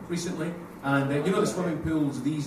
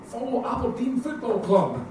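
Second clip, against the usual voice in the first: slow, higher mock-proud slogan; sticker slogan read out; 5 semitones higher, slower than usual. Comic reading the sticker to the Aberdeen crowd, slow mock slogan. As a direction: read it like a proud fan's motto. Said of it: "This one said".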